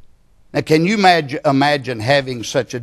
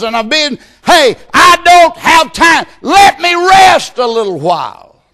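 much higher, loud, exasperated shout; God voiced as worn out; 11 semitones higher, 8 dB louder than usual. God answering the praying man, fed up and shouting. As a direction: cry out like a God fed up with prayers. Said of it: "And a voice says".

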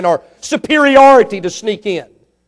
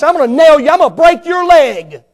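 louder, higher hammed-up threat; mock threat; 6 semitones higher, 6 dB louder than usual. Player threatening opponent after a tackle, mock loud. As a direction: get in his face, mock tough.

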